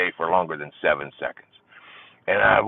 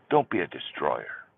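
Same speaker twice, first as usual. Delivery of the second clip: ordinary delivery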